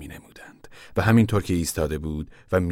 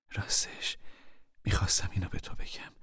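whisper; 10 dB quieter than usual. Acted. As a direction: confide it in a hurried whisper. Mother whispers at night.

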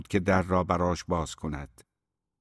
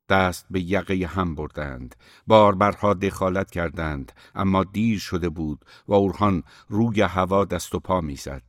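plain reading voice

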